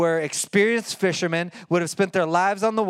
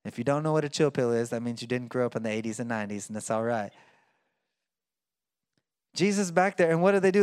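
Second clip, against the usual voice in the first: ordinary talk, nothing marked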